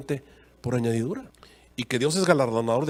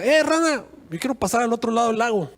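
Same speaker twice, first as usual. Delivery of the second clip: alacrán gritando, mucho más agudo; llama a la rana desde la orilla, agudo; grito del alacrán; 6 semitones higher than usual. Acted. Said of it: "le gritó a la rana". (el alacrán le grita a la rana, agudo y burlón)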